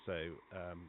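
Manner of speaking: ordinary talk, nothing marked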